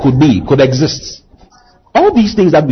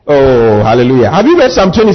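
usual speaking voice

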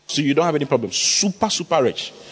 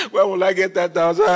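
mock lament. Preacher mimicking someone who longs for ten thousand, hammed-up wailing. Mimics person fretting over money.

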